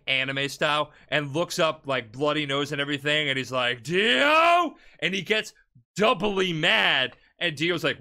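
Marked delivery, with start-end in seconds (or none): 3.8-4.8 a bloodied hero's enraged cry; enraged shout. Retold or imitated. JoJo, bloody-nosed, roaring Dio's name in rage. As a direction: roar the name, much higher and louder.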